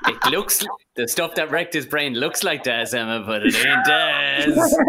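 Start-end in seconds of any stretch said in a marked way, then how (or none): 3.2-4.8 slow, loud mock TV advert; hammy advert voiceover; ad voice parody.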